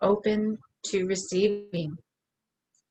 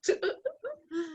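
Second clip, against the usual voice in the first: bright high-pitched giggle; 14 semitones higher, 7 dB quieter than usual. Real, not acted. Giggling at partner.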